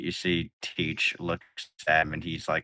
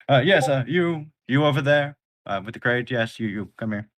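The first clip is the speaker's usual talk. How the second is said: higher, louder call to a crewman; calling over; 6 semitones higher, 6 dB louder than usual. Acted. Thatch calling a crewman over, higher and louder. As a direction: summon the man with the crate.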